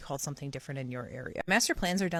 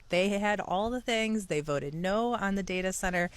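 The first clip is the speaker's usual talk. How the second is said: ordinary delivery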